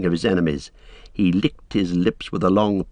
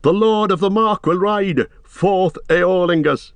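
high, rousing battle cry; armed men hailing their lord; 10 semitones higher, 3 dB louder than usual. A narrator's reading. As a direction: cry it out high, rallying riders.

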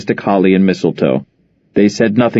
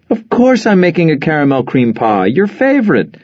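much higher, bright surprised mother; delighted phone greeting; 6 semitones higher than usual. Acted. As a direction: greet your daughter warmly on the phone.

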